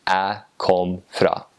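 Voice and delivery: "Jeg kom" chopped to a bare "æ kom", slow Northern Norwegian; Northern Norwegian dialect. Shown as a demonstration. Contrasting with the Eastern form, slow Northern Norwegian dialect. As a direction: say it slowly in North Norway dialect. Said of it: "in Northern dialect, they would just say".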